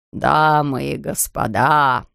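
low, slow formal proclamation; announcing. A narrator's reading. Factory owner calls the guests to order, slow formal announcement. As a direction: proclaim it to the crowd. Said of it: "возвестил он".

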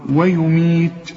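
ويميت slow with the ي held two counts; tajweed madd; even madd. Shown as a demonstration. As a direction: hold the ي in ويميت evenly. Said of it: "المدود بتبقى قد بعض".

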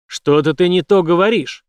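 loud warning bark; menacing shout. A narrator's reading. Villain warning Jane, loud menacing shout. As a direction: warn her loudly.

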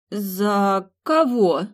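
very slow confused За кого; bewildered. A narrator's reading. Anna confused by Milly's question, slow bewildered. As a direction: ask back, lost. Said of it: "растерянно переспросила Анна".

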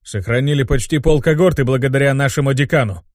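plain reading voice

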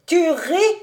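affronted, rising challenge; contained indignation. A narrator's reading. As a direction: demand it, holding anger in. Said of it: "fit-il en se contenant".

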